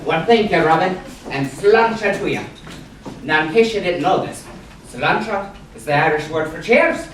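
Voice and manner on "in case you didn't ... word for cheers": ordinary talk, nothing marked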